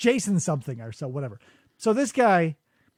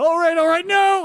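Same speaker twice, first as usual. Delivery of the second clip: high loud frantic pleading; mock panicked all right; 10 semitones higher, 7 dB louder than usual. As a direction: beg him to stop like you're being hit. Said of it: "as if he started hitting him in the head with a hammer".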